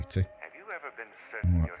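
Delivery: ordinary delivery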